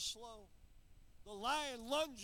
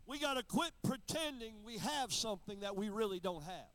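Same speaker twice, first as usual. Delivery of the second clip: full-force preaching; 7 dB louder than usual. Acted. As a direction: preach it hard to the congregation.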